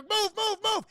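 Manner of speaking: high, loud hurry-up shout; hammed-up emergency yell; urgent mock command. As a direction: play someone rushing the medics along. Calling medical staff onto field.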